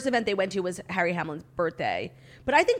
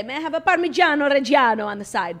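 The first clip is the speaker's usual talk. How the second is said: Italian accent; 4 semitones higher, 6 dB louder than usual. Shown as a demonstration. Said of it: "But I will say".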